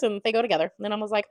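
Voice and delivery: normal talking voice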